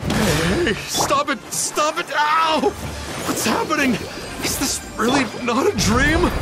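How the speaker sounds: high, alarmed yelps; panicked protest. Acted. Dreamer finding the attack is real, panicked. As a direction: cry out under attack, voice pitched right up.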